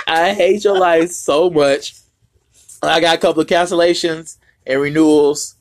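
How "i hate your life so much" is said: laughing through the words; teasing through giggles. Real, not acted.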